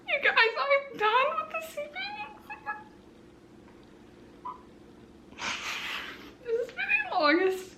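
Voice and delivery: high, elated rush to viewers. Real, not acted. Announcing to viewers she's done with the exam, high elated.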